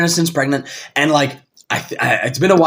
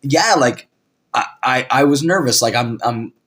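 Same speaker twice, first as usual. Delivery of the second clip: much lower, softer, halting confession; sincere admission; 7 semitones lower, 4 dB quieter than usual. Acted. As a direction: quietly own up to nerves, with hesitant restarts. Voicing a sincere Chandler instead.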